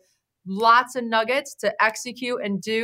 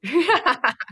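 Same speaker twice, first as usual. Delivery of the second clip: short, higher-pitched real laugh; 6 semitones higher than usual. Real, not acted. Host reacting to guest's praise, laughing. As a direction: burst into a brief, high laugh.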